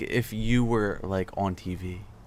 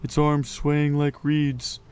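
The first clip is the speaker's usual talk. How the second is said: story reading; 3 semitones higher, 3 dB louder than usual. Acted. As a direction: read the passage aloud, unhurried. Reads the book passage aloud.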